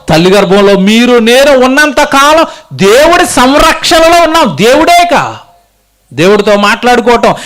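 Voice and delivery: high, ringing sermon proclamation; full-force preaching. Acted. Preacher proclaims God's care in the womb, fervent preaching.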